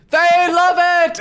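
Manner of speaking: high, loud excited call; excited announcement. Acted. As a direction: announce it thrilled. GM announces crowd reaction.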